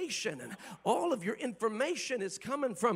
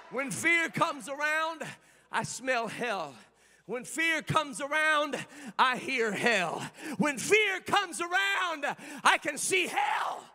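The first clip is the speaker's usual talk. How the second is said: full-force preaching; 4 semitones higher, slower than usual. Real, not acted. Pastor to the congregation, preaching at full force.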